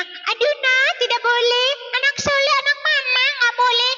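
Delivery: high, pleading mock-sweet mum voice; syrupy high-pitched mother begging the child; wrong way to scold. Shown as a demonstration. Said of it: "Jangan ini mah".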